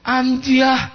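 very high, mock-disappointed "Amjah"; high exclamation feigning disappointment; disappointed intonation. Shown as a demonstration. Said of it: "kecewa dah intonasi itu".

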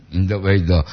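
ordinary delivery

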